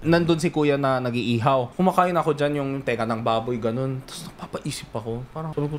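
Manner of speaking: normal talking voice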